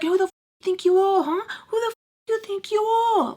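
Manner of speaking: imitated angry challenge. He scolds her for not listening, imitated angry challenge.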